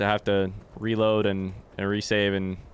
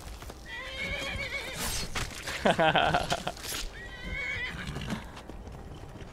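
high laugh; 7 dB quieter than usual. Real, not acted. Long laugh.

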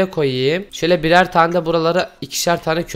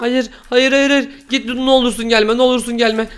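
panicked pleading; 6 semitones higher than usual. Real, not acted. Fleeing attacker in game.

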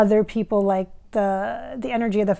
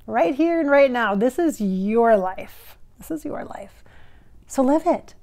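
rising, louder uplifting call; inspirational speech; 3 dB louder than usual. Acted. Inspirational sign-off.

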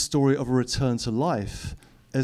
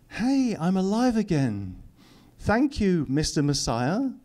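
mock grateful; 6 semitones higher than usual. Mock quote of revived Lazarus.

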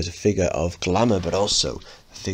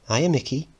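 put-on high girl's voice; 8 semitones higher than usual. Linda voiced.

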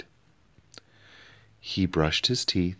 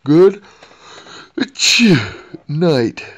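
much higher, louder words burst out in a sneeze; sneezing; 11 semitones higher, 13 dB louder than usual. A narrator's reading. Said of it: "he sneezed".

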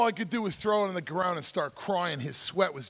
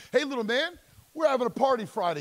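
high, loud call across the courts; older teen calling after a little boy; 9 semitones higher, 7 dB louder than usual. Retold or imitated. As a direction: shout the invite to the kid walking off. Older kid calling out invitation.

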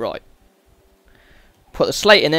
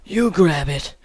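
breathy mock creepy character voice; kinky weird voice; 4 dB quieter, slower than usual. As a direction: imitate the weirdo's suggestive line. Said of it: "That's how he sounds when he says it".

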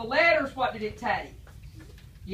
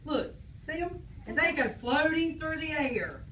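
put-on reading to children; 2 semitones lower, 6 dB quieter than usual. Teacher reading a picture book to the class, storybook voice. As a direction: draw the kids into the story.

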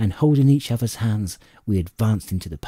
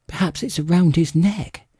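suggesting; 8 semitones higher than usual. A narrator's reading. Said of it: "suggested my mother".

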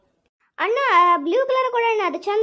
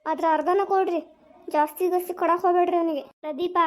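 normal talking voice

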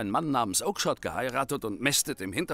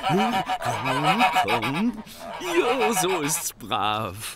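higher, louder, slow and laughing coax; 5 semitones higher, 6 dB louder, much slower than usual. Acted. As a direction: sweet-talk the goose into swallowing. Coaxing a goose to swallow.

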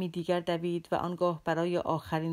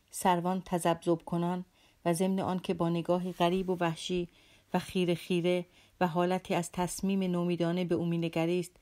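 plain reading voice

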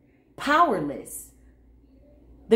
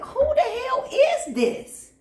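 incredulous scolding; 7 semitones higher than usual. Retold or imitated. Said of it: "Oh, I'm like".

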